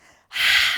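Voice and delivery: big loud sigh through the mouth; forceful sigh. Shown as a demonstration. Teacher exhaling with the class, loud forceful sigh. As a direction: push the breath out hard.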